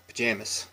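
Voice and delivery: pajamas ending in a quick, chopped s; local pronunciation. Shown as a demonstration. Showing viewers the chopped local ending. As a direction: pajamas with the final mas clipped short. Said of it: "the M A S at the end is quite quick".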